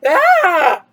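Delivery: loud frightened yell; startled yell. Acted. Jack is startled by Sally and yells loudly. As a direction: yelp as if jumped. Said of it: "Jack yelled".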